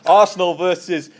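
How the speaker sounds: slow, loud, exaggerated Borat-style announcement; hammed-up Borat accent on the team names; mock Borat accent. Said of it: "That's my Borat accent as well".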